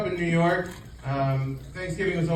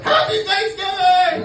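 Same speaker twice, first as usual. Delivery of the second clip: very high, loud, drawn-out comic yell; Seinfeld; 7 dB louder, slower than usual. Retold or imitated. Comic yelling to parade crowds, high strained impression. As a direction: holler it slowly, pitched way up. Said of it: "start sounding like Seinfeld".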